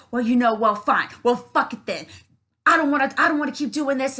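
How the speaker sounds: loud, agitated mock outburst; imitated rage. Narcissist losing an argument, mock angry outburst. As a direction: act out a flustered angry refusal. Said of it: "they can get this rage inside of them".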